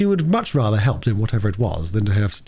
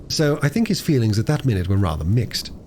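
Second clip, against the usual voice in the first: plain reading voice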